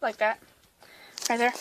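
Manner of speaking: talking around a flashlight; words muffled by object in mouth. Real, not acted. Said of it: "with the flashlight in my mouth".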